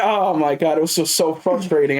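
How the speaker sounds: usual speaking voice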